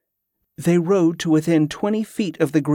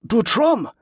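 high-pitched, contained shout; sudden shout; 7 semitones higher than usual. A narrator's reading. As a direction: call out suddenly, without much volume. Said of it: "Giacomo suddenly shouted".

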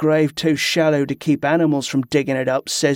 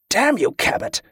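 a tense, held-down snap, pitched higher; snapped curse; 8 semitones higher than usual. Acted. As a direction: bite it off sharply while keeping the volume down. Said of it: "Ambrose snapped, keeping his voice as low as he could".

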